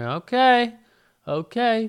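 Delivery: high, loud, forced okay; strained okay. Retold or imitated. To the waitress who promised food, a high strained okay. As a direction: chirp okay through clenched patience.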